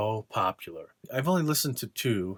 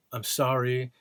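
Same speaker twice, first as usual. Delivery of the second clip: ordinary talk, nothing marked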